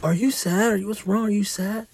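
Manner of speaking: put-on high, insistent child voice; imitating questioning kids. Kids pestering their crying dad with questions, high imitated child voices. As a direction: pepper him with questions like a small child. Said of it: "they ask me a million fucking questions".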